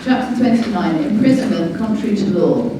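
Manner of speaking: usual speaking voice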